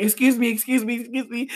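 flag someone down in a high little voice; high, urgent, small-voiced plea; nervous calling out. Calling timidly after the star.

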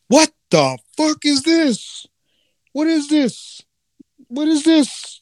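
high, slow, baffled; Adam staring down at himself, utterly lost; bewildered Adam. Retold or imitated. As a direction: stretch it out in high-pitched confusion. Adam discovering his body.

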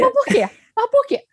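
high, repeated why; insistent why. Shown as a demonstration. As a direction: keep pressing the why up high. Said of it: "exercício do porquê". (porquê insistente e agudo)

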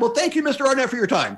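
high, laughing mock presenter cutting him off; a laughing send-up of a BBC presenter; mock BBC host. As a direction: brush him off like a hurried anchor.

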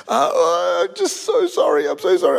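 imitated sobbing apology, pitched high; put-on weepy voice; mock tearful. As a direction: blubber it like a guilty assistant. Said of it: "She's in tears".

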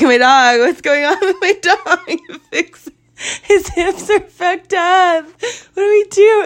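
high, drawn-out wail through laughter; mock panic. Owner pleading with vet staff over her dog, mock panic. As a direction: retell the panic as a laughing, high-pitched plea.

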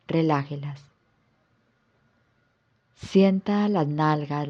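calm yoga nidra guidance with long pauses; guided relaxation. Acted. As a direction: guide the listener into rest, phrase by unhurried phrase. (relajación guiada, con calma y pausas largas)

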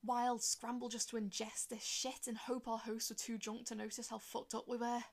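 usual speaking voice

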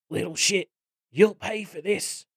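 high-pitched strained snarl; snarled threat. A narrator's reading. Willy threatens the goblin climbing him.

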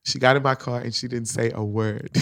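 normal talking voice

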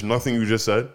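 normal talking voice

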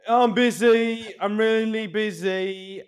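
high, drawn-out mock Yorkshire whine; Yorkshire twang. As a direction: imitate a busy Yorkshireman, stretching the words out high and loud. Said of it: "is that a Yorkshire twang".

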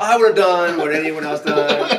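slow, high, hammed-up modest hero voice; mock humble hero. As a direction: play the falsely humble hero, drawn out. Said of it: "'Cause what he was like".

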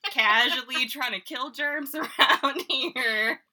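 slow, high, laughing protest. Real, not acted. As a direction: laugh through the excuse. Laughing self-defense, slow.

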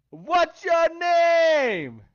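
high, loud, very slow drawn-out "What's your name?"; TikTok impression. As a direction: stretch each word like the viral clip. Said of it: "I know y'all seen the TikTok".

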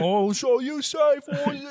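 high, drawn-out mock British accent; thick British accent. Drunk passenger jeering at flight attendant, thick British accent. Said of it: "like, a thick accent".

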